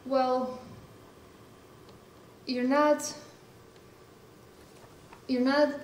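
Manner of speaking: ordinary talk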